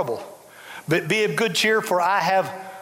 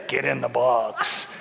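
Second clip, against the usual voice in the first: much lower, slow, drawn-out command; voice of God; 12 semitones lower, slower than usual. Retold or imitated. Preacher jokes about God's call into a casket, deep slow God voice. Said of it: "I've waited for him to say".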